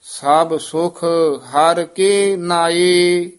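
measured chant with held line endings; scripture recitation. Acted. Teacher models Gurbani for students, measured chanted recitation. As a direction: recite evenly, pausing between phrases.

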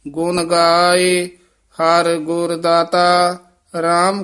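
measured, sustained Gurbani recitation; scripture recitation. Shown as a demonstration. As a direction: recite phrase by phrase, holding the endings.

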